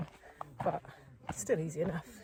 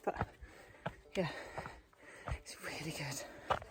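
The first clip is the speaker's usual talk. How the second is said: out of breath; 6 semitones lower, 8 dB quieter, slower than usual. Real, not acted. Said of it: "as you can hear, out of breath".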